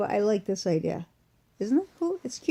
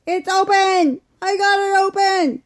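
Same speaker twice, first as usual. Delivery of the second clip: much higher, louder cry of victory; triumphant; 11 semitones higher, 9 dB louder than usual. Real, not acted. Vlogger finally opening the stuck tube for viewers, triumphant cheer. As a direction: celebrate beating the stuck tube.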